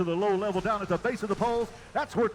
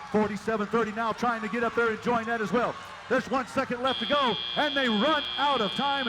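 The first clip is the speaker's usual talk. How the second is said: higher-pitched, urgent countdown commentary; excited play-by-play; 4 semitones higher, 3 dB louder than usual. Real, not acted. Announcer calling the match's final second to the crowd, excited. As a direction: call the last seconds with rising excitement.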